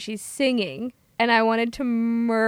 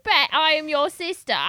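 lightly put-on Jersey accent; brash Jersey twang; mock Jersey accent; 4 semitones higher than usual. Actress sliding into a mock Jersey accent. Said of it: "now I'm from Jersey".

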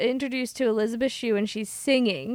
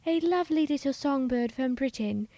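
lightly put-on British accent, softer; mock British accent; 2 semitones higher, 4 dB quieter than usual. Actress switching to a mock British accent. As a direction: play the lovely little British songbird. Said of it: "And then it was like".

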